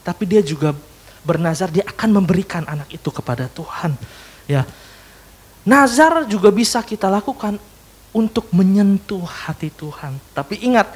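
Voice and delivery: normal talking voice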